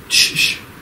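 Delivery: imitated call for silence; hushing. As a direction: hush the room with two shushes. Acting out hushing the devotees.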